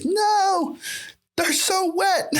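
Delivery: high-pitched squealed mock protest; hammed-up high distress; mock torture victim. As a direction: wail it like a pretend victim. Acts out victim of finger torture.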